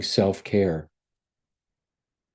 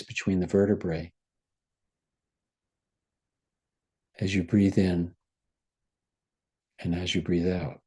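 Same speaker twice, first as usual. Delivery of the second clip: guided meditation. Acted. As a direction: gently guide them into relaxation. Guided body relaxation.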